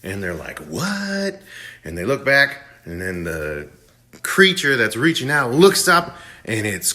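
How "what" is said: high-pitched, incredulous "what"; startled question. Retold or imitated. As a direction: play a mock double take, voice jumping up. Shocked reaction acted in retelling.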